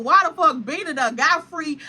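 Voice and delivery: ordinary talk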